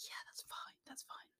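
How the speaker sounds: very soft, breathy agreement; tiny whisper. Retold or imitated. Whispering meek agreement to the directors. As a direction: whisper it meekly, eager to please.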